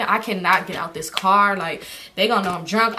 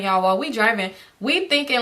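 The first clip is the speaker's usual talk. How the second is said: ordinary talk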